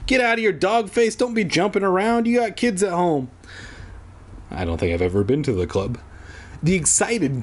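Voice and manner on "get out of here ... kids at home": high, fast heckling yell; mock yelling. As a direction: heckle someone across a club. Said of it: "I'm gonna start yelling".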